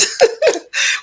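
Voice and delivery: breathy giggle; a quick giggle mid-sentence; laugh. Real, not acted.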